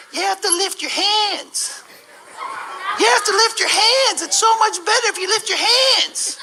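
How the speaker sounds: put-on excited teen girl. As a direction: gush like a thrilled teenage girl. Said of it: "she tells her friend".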